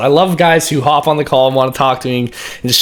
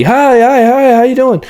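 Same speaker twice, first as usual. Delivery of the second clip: high, loud, bubbly hi hi hi; overexcited greeting; 11 semitones higher, 4 dB louder than usual. Shown as a demonstration. Sales coach showing the wrong overexcited greeting to a calm client. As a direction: gush at them with far too much pep. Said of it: "If they're a lower tone person, you don't wanna be".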